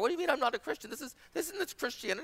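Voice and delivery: high-pitched imitation of baffled objection; mock indignant protest. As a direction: act out a young man's incredulous pushback. Acted young man disputing pastor's judgement.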